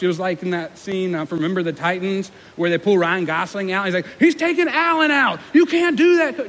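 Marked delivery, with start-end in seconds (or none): 4.2-6.5 high, loud movie-parent protest; yelling at the coach. Acted. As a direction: yell in outrage from the sidelines. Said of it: "other parents yelled at the coach".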